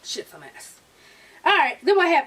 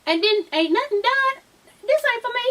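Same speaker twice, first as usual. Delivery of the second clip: Bertha; 7 semitones higher than usual. Retold or imitated. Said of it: "Bertha's like".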